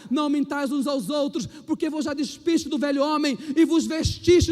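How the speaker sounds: fervent scripture proclamation, building loud; preaching scripture. Acted. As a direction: proclaim the verse with rising power. Reads scripture at full force.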